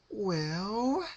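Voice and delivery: high, bubbly, sing-song; cheery Pinkie voice. A narrator's reading. Pinkie asking her captive for advice, high and bubbly. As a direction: chirp it like a sweet party pony.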